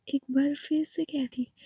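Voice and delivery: ordinary delivery, nothing marked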